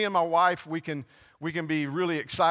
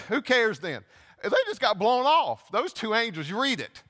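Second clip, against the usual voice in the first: ordinary delivery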